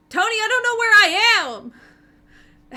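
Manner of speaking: lost, desperate cry for help; high, loud, drawn-out wail; Stick's voice. Acted. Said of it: "you hear Stick going".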